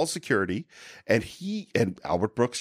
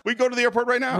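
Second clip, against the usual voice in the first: imitating the rant; 10 semitones higher, faster than usual. Quoting the film line.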